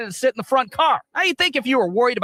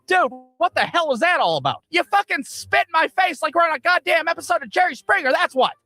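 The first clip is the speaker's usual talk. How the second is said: higher-pitched retold outrage; mock indignant; 7 semitones higher than usual. Rider and friend arguing after the spit, mock indignant. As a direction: play up the indignation, imitating a quarrel between friends.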